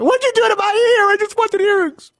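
very high frantic whine; shrill hysterical movie voice. Acted. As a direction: squeal it like a panicked victim. Quoting movie character.

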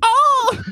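high squealed Oh of pain; mock pained cry. Old lady startled after being smacked, mock yelp. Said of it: "What was she like?".

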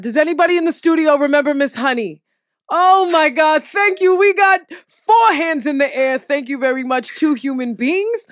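rally the studio crew, fast; calling out. Real, not acted. Host calling out to the studio crew, loud and eager.

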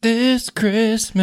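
slow, high, mock-solemn repeat; dramatic mock echo.

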